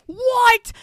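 high, very loud indignant squawk; outraged yell. Retold or imitated. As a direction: yelp it in mock offence. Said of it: "takes so much umbrage to this".

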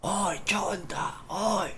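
high-pitched mock shout, no real volume; imitated calling out. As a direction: imitate friends calling from outside. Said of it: "y gritan, pes".

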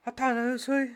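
high, loud put-on Scouse accent, through the nose; nasal Scouse voice. Friend teasing his mate on a call, in nasal Scouse voice. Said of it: "the Scouse accent come out".

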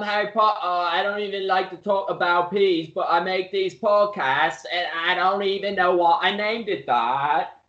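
lightly hammed British accent; mock British accent. Mocking British podcaster.

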